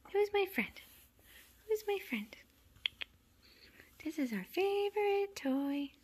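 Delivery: very high cooing pet voice; pet talk. Real, not acted. Asking a kitten who her friend is, high pet talk. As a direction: coo high at a beloved kitten.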